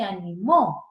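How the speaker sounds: ANIMÓ stressed on MÓ; final-syllable stress. Shown as a demonstration. Teacher using the aguda in a sentence, stress on MÓ. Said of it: "se volvió una aguda".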